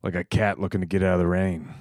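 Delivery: hardboiled noir narration; noir detective. Acted. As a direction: narrate like a weary private eye. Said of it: "It's noir".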